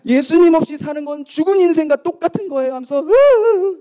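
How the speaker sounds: high imitated crying, breaking into a loud moan; mock tearful wail. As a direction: lament it, then dissolve into hammed-up sobs. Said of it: "막 우는 거예요".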